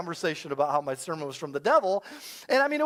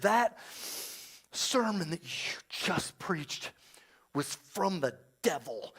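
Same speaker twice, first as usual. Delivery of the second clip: hushed, halting, near-whispered accusation; shaken accuser; 3 semitones lower, 7 dB quieter, slower than usual. Retold or imitated. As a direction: imitate a shaken woman, slow and very soft. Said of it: "she was visibly shaking".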